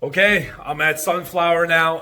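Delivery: ordinary talk, nothing marked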